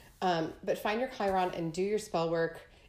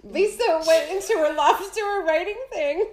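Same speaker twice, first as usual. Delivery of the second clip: laughing; 9 semitones higher, 6 dB louder than usual. Real, not acted. Retelling while still laughing.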